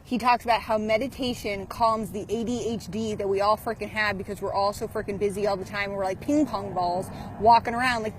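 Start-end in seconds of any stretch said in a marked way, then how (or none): none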